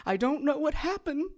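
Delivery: high, soft fake tearful pleading; mock grieving husband. Kay mimicking a killer lying to police, fake tearful whine. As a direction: play the innocent, fake the tears. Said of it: "and it's".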